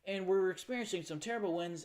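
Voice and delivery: news reporter. Retold or imitated. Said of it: "like, a news reporter".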